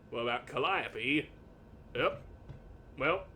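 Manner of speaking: ordinary delivery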